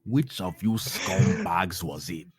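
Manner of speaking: slow mock Nigerian accent; drawn-out playful Nigerian accent. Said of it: "my, uh, Nigerian accent".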